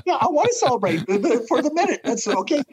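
high, quick, laughing protest; hammed-up squeaky-high objection through laughter; mock protest. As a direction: play a flustered client, pitched right up. Acts out debtor's protest amid laughter.

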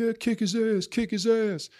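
high-pitched mock crowd chant of kick his ass; imitating a rowdy crowd, voice pushed high; egging on a fight.

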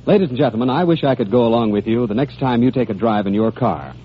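usual speaking voice

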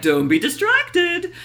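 put-on high, slow, prim kids' show host voice; Dora Smarmy voice. Dora Smarmy teaching kids to ignore screen chaos, prim and high. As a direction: sweetly lecture the children to stay focused. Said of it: "she's like".

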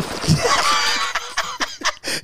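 long high-pitched laughing fit; real burst of high laughter; cracking up laughing. Real, not acted. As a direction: crack up helplessly at your own story. Cracks up at own story.

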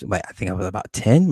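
ordinary talk, nothing marked

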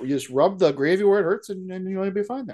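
hammed-up, accommodating Canadian; Canadian accent. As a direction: reassure them in a folksy Canadian accent. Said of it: "whole fucking movie in Canadian accents".

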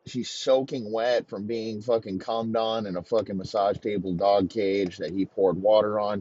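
ordinary delivery

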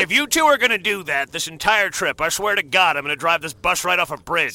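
loud exasperated threat; fed-up driver yelling; angry. Acted. Driver snaps at passengers.

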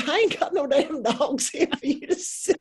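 high, laughing Southern voice; Southern US accent. Retold or imitated. Boss jokes about having no dogs to sell, in Southern accent. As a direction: tease her back in a Southern accent. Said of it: "you are so good at the accent".

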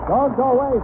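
normal talking voice

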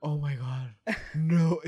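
usual speaking voice

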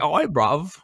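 loud, high-pitched English caricature; high mock English accent. Host teasing how his friend does English, high mock English accent. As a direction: squeak it out as a cartoon Englishman, loudly. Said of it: "always an octave higher".

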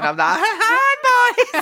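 put-on high, camp, laughing Hi boys; camp Kenneth Williams.

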